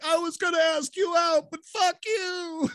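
comic screamed rejection, slowing at the end; spurned suitor's yell. Acted. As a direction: wail it in hammed-up indignation. Acted rejected suitor yelling at her.

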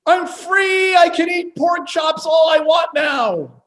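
joyful, high, loud exclamation; excited shout. Retold or imitated. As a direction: shout it out like newly freed. Imagined Peter rejoicing over food.

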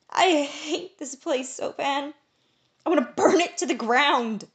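high, loud, slow crying outburst; sobbing. A narrator's reading. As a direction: cry it out in despair. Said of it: "he cried between guffaws".

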